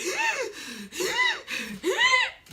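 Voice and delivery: sharp, high hyperventilating gasp; gasping breath. Retold or imitated. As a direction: gasp for air mid-sob. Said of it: "I was hyperventilating".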